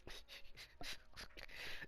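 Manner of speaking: soft, breath-only chuckling; amused laugh. Real, not acted. Amused by Tokyo Tower joke.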